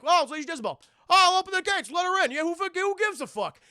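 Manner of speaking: high, loud, dopey guard waving them in; dumb guard voice. Retold or imitated. Careless guards open gates.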